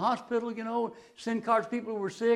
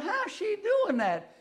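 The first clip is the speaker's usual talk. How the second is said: much higher, slow wonder; amazed; 12 semitones higher, slower than usual. Retold or imitated. Pastor wonders how Ruby beat him to the hospital, amazed.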